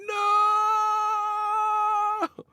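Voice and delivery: long, very high screamed no with a cry. Retold or imitated. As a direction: howl no like Van Damme.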